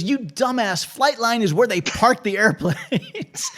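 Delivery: the shop guy laughing at the rookie; mocking put-down. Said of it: "He goes".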